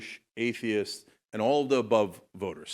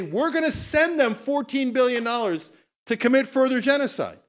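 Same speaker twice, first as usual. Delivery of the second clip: mocking Democrats; 12 semitones higher, 4 dB louder than usual. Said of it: "now you're gonna come back and say".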